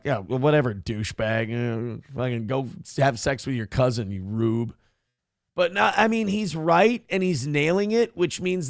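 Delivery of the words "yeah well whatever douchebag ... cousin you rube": low, offhand brush-off; mock dismissiveness. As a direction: wave him away with lazy contempt. Mimics a dismissive listener.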